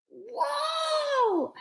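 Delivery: very high full shout; top of her lungs. Acted. Usha shouting at the wall at the top of her lungs. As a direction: shout with everything she has. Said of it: "Usha shouted at the top of her lungs".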